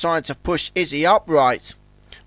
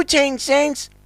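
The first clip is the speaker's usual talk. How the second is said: mocking; 10 semitones higher than usual. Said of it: "mocked Zug".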